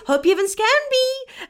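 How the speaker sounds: high, pointed mock sweetness; passive-aggressive. Buyer needling the seller, imitated passive-aggressive. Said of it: "what would that passive aggressive".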